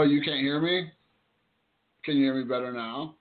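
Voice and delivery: ordinary delivery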